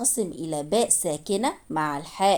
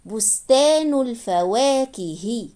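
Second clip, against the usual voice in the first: slow, measured reading with held long vowels; slow reading demo; slower than usual. Shown as a demonstration. Teacher reading the finished phrase to learners, slow and drawn out.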